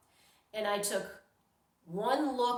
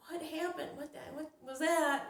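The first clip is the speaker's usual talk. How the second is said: higher, bewildered and jolted awake; startled confusion; 8 semitones higher than usual. Retold or imitated. Woman jolted awake at night asks what happened, startled and confused. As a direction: ask it dazed, as if just woken by a shock. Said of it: "I'm like".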